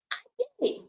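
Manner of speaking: breaking into a high tearful crack; choked-up, tearful admission; crying voice cracking high. Real, not acted. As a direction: voice catching on a sob. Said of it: "my crying, angry ass".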